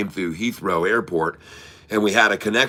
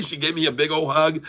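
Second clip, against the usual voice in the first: plain reading voice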